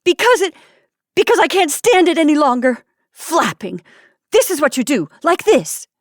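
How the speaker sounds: wife shrieking her grievance at her husband; shrill outburst. Acted. Wife in quarrel.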